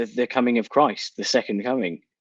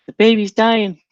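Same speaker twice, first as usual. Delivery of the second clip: mock concern; 10 semitones higher, 4 dB louder than usual.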